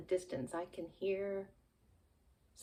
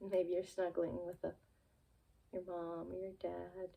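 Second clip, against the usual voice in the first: ordinary talk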